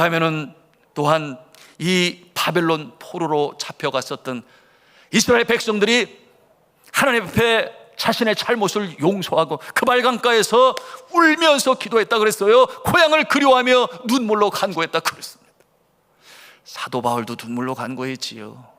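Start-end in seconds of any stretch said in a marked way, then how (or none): 5.1-15.4 high, forceful preacher's delivery; impassioned preaching. Acted. Preacher urging the congregation that we are weaker than children, impassioned. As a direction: press it home in a raised, urgent pitch.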